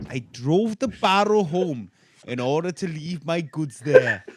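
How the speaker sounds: put-on comic Welsh accent reading a witness account; funny Welsh accent. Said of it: "read his account of finding the body in a funny accent".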